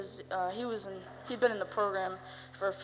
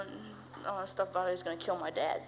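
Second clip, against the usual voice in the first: ordinary delivery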